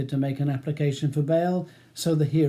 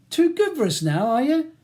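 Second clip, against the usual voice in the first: loud, high, contemptuous taunt; spitting; 11 semitones higher, 6 dB louder than usual. A narrator's reading. Ronnie taunting Charles for refusing help, spitting mad. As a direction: spit it out with rising anger. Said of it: "he spits".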